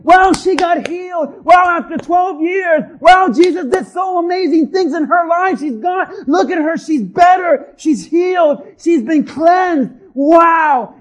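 loud, high, excited wows; acted jubilant onlookers shouting the good news; celebrating crowd. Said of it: "everyone's celebrating".

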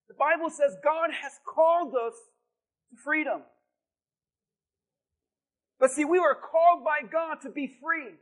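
a touch of rising sermon fervour; preaching. Acted. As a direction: press the message home to the church. Preaching to the congregation.

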